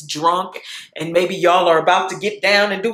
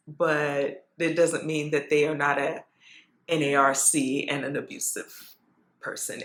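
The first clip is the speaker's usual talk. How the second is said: dropped to a near whisper, much softer; hushed aside; 3 semitones lower, 8 dB quieter than usual. Real, not acted. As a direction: confide it quietly, as a secret. Confiding about a past abuser.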